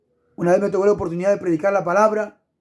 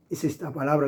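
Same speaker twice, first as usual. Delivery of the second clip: usual speaking voice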